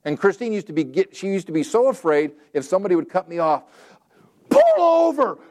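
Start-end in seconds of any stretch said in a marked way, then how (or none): 4.5-5.4 high, loud re-enacted road-rage shout; upset yelling. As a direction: yell it at another driver, high and drawn out. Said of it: "I'd just be that upset".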